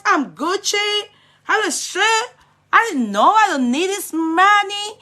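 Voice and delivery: mocking the girl's accent. Commentator mocking the girl's brag video, high mock voice. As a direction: mimic her bragging in a shrill-up put-on voice. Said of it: "She just dey speak".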